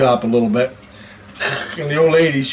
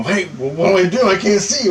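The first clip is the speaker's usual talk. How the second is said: retold alarm; 6 semitones higher than usual. Asking instructor, panicked, retold.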